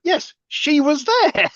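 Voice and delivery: high, slow, drawn-out mock exasperation; exasperated. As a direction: spell out the obvious, high and slow. Exasperated mock reply to character.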